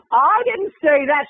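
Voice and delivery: high, slow, drawn-out protest; mock scared denial. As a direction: plead innocence, hammed up. Retold frightened denial to her threatener.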